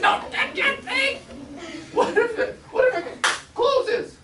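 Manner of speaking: high, loud, slow plea through laughter; laughing panic. Retold or imitated. Little brother pleading with Gina not to touch the trap, laughing scared. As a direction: beg her to stop, half laughing.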